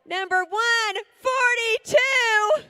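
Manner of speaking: slow, loud announcement of the number; drawn-out, loud emcee call. Real, not acted. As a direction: call it out slowly to the whole room. Announcing winning paddle number.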